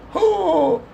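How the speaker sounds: high, loud mock moose call hoo. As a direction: bellow it like a bull moose calling a mate. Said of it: "is when the moose goes".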